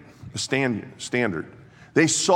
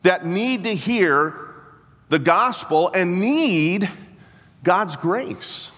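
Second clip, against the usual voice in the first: preaching at full force; 9 semitones higher, 4 dB louder, slower than usual. Acted. Preaching climax.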